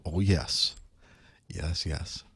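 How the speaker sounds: normal talking voice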